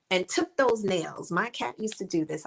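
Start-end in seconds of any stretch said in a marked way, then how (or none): none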